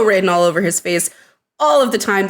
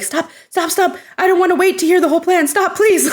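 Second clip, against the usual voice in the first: panicked pleading; 10 semitones higher than usual. Retold or imitated. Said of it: "just that panic where you're like".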